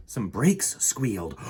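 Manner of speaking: usual speaking voice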